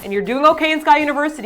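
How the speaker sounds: ordinary delivery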